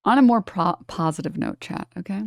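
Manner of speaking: ordinary delivery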